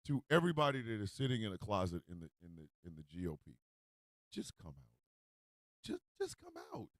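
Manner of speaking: very soft, low urging; hushed appeal. Real, not acted. Host urging closeted GOP members to come out, hushed. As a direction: let it sink to a near-murmur. Earnest aside to closeted politicians.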